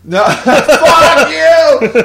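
high, loud laughing outburst; laughing shout. Real, not acted. Reacting to friend's joke, laughing.

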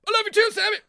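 put-on squeaky high-pitched girly reply; mock high Julie voice. Imitating his co-star's high squeaky line to him. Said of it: "and she went".

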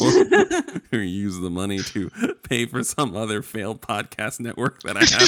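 chuckling through the punchline; laughing. Real, not acted. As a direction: let the laughter spill over the words. Joking about failed network.

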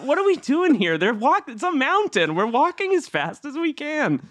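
high-pitched protesting hostage; mock-indignant, high-pitched complaint; acted exasperation. Voicing exasperated hostages on mountain.

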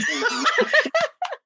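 a burst of high-pitched laughter. Real, not acted. Mother laughs recalling buying the domain, high laugh. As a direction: let a big high laugh out.

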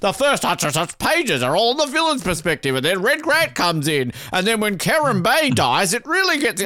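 a light, rising mock-lecture voice; mocking the intro writer. Podcaster mimicking the intro writer's spoilers, lightly mocking. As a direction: parody a know-it-all spoiling the plot. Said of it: "He's literally going like".

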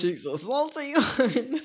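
slow, high, teasing voice; teasing drawl. Pretend. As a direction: stretch it out to tease her. Teasing a co-host.

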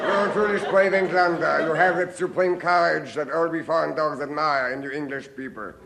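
put-on sneering German accent, a touch amused; a hammed-up German officer; mock German officer. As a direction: condescend to the brave Englishman in German tones. German officer mocking prisoner.

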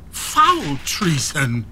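high, raging outcry; outraged cry. Acted. Father reading the plot letter, shrieking in rage. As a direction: hurl each word in fury.

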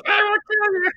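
very high put-on creature voice; mock insectoid queen; imitating alien queen. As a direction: copy the queen's threat. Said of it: "she just goes".

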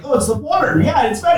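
loud high mocking voice of the locals. Mocking the locals' bagel claim, loud and high. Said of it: "they were like".

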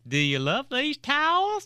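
playful mock country drawl. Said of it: "she had this country twang".

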